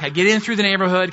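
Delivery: ordinary talk, nothing marked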